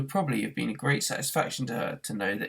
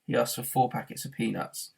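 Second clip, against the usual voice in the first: ordinary delivery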